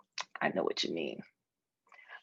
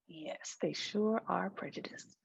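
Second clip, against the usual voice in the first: soft, conspiratorial whisper; 9 dB quieter than usual. Acted. Friend agreeing the others are prejudiced, whispered. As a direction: agree in a hush.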